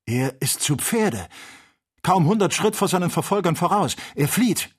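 erst gedehnt, dann hoch und laut; aufgeregt. A narrator's reading. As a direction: steigere dich in den erregten Ausruf. Excited report of the chase. (zunehmend aufgeregt)

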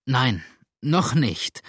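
plain reading voice

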